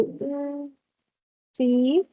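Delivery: a whispered "Mm" then a hesitant "sí"; unsure. Shown as a demonstration. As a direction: hedge as if you did not understand. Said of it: "no se me escucha segura al hablar". (inseguro, sin convicción)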